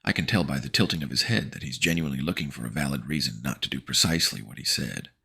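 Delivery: plain reading voice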